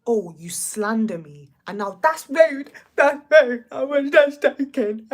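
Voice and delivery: loud exaggerated imitation of Whitney; hammed-up indignant quote, slow then blaring; mock Whitney, loud. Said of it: "What did Whitney say?".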